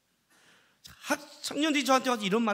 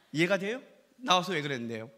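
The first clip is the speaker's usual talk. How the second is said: ordinary talk, nothing marked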